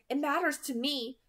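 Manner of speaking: mean every word; intense. A narrator's reading. Bella insisting to Edward that it matters, intense. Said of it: "she said intensely".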